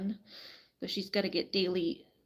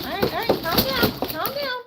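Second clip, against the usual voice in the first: hurried calming; 13 semitones higher, 6 dB louder than usual. Real, not acted. Calming flapping hen.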